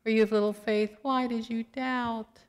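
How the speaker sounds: gentle. Pretend. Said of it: "We don't know what his tone was".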